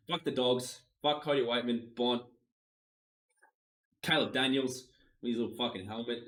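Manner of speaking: usual speaking voice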